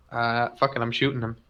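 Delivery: normal talking voice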